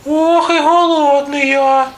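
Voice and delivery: very high squeaky mouse moan; hungry little mouse. A narrator's reading. Hungry mouse arrives for the pies, squeaky voice. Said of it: "каже круть".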